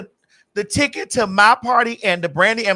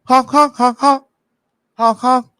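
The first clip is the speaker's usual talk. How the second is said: loud honks like a car horn; horn honking imitation; 2 semitones higher, 5 dB louder, slower than usual. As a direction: honk like an impatient driver.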